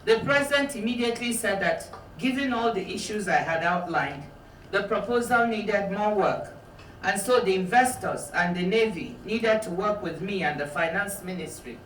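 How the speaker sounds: calm, even reading from a memoir; measured author reading; book reading. Acted. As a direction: read the passage steadily to the audience. Reads aloud from her book.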